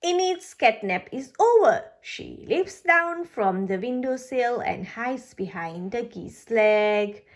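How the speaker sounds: plain reading voice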